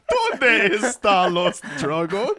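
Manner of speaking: slow, high, laughing fake accent; mock accent. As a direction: ham up a silly accent. Joking voice after Batman bit.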